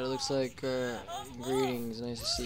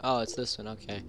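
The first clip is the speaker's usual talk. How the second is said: ordinary talk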